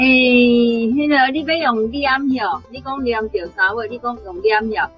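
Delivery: ordinary talk, nothing marked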